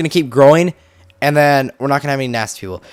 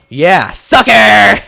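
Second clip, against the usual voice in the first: drawn-out, louder gloat; gloating taunt; 5 dB louder, much slower than usual. Real, not acted. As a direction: rub it in the skeleton's face. Gloating after surviving arrow.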